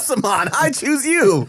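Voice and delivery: high, rising heroic call-out; mock battle cry. Co-host calling on the curry like a champion, mock battle cry. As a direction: summon it like a cartoon hero. Mimics a game catchphrase with curry name.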